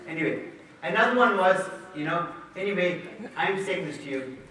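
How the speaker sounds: ordinary delivery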